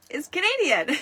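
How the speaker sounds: squealed high and loud with delight; spring the word up like a happy reveal; excited. Real, not acted. Host revealing the hero is Canadian, delighted squeal.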